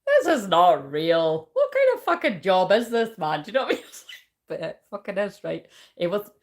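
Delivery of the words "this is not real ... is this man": exasperated disbelief. Retold or imitated. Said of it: "sometimes I'm like".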